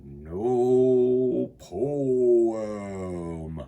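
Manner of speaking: long drawn-out sigh of a noodle. Acted. Noodles in the cabinet answering Arthur with a sigh. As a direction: breathe it out like a tired noodle. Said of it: "the noodles sigh in reply".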